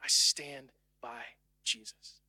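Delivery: hushed, low whisper of devotion; whispered vow. Pretend. Believer whispering to the world he stands by Jesus. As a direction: breathe it like a secret promise. Believer quietly declares loyalty.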